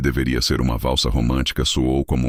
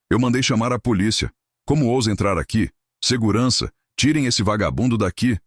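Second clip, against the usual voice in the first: much higher, indignant yelling; shrill anger; 9 semitones higher than usual. A narrator's reading. Evandro calling security on the beggar, shrill angry orders. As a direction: order him thrown out, voice cracking. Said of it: "a voz esganiçada".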